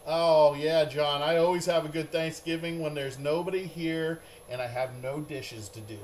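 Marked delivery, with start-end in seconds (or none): none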